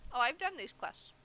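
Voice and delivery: normal talking voice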